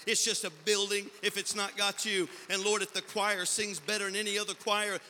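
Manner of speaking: loud, fervent pulpit plea; full-force preaching. Real, not acted. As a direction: cry out to God like a preacher. Retold prayer swells into preaching.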